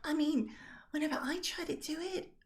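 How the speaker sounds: very high-pitched game character; Rosalind, gushing then self-deprecating. Acted. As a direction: praise a friend, then fret, voice way up.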